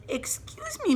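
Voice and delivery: put-on very high little-girl "excuse me"; girl's polite interruption. A narrator's reading. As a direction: pipe up like a small girl getting attention. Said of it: "And then this girl says".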